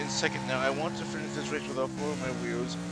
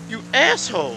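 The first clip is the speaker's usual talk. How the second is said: loud, high-pitched insult; angry yell; 10 semitones higher, 12 dB louder than usual. Real, not acted. Rammed racer to the culprit, angry yell. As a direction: hurl it at the offending driver.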